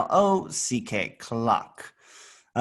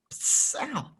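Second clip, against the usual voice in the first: high unvoiced pss then ow; hot-touch hiss and ow; 11 semitones higher, 5 dB quieter than usual. Shown as a demonstration. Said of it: "like when you touch something hot".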